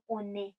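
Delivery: "اونه" with a fully sounded n; liaison with n. Shown as a demonstration. Teacher shows French liaison, "اونه" with full n. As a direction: link it as "o-né", the "نه" complete.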